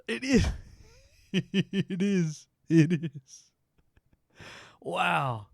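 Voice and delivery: high, laughing disbelief. Real, not acted. Gamer spotting a silly achievement, laughing. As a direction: squeal it through giggles.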